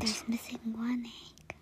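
a soft unvoiced whisper. Real, not acted. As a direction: tell it as a secret. Child whispers answer.

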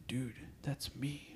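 hushed, drawn-out whisper; whispered realization. Retold or imitated. Telling co-host about recognizing his own burnout, whispered realization. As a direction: whisper it slowly, as if it just dawned.

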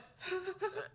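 a half-laughing, half-crying yelp; panicked laugh that cracks into a whimper. Real, not acted. Panicked moment seeing the doll.

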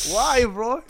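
high, loud, startled why bro; shocked retort. Retold or imitated. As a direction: jump up in pitch, taken aback. Friend tells him off, he reacts.

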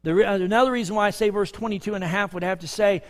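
ordinary talk

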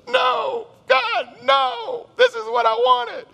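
very high-pitched whiny tantrum; hammed-up squealing protest; mock wailing. Acted tantrum at God's refusal.

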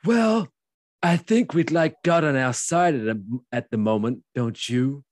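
put-on high, loud Cage accent, easing off; hammed-up imitation of Cage; Nicolas Cage impression. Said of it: "do that without the accent".

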